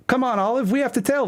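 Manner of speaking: pleading, pitched high. Acted. Ollie begs Olive to come tell them, high and whiny. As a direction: beg her in a high whiny voice.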